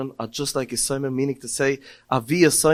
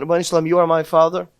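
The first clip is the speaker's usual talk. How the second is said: a raised, pleading cry, half in tears; tearful plea; 3 semitones higher, 7 dB louder than usual. Retold or imitated. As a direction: call out to him as a weeping child. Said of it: "he's crying".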